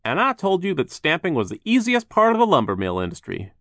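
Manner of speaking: high, loud, upbeat voice; cheerful worker voice. Acted. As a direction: reassure the worried sisters. Voiced Phil.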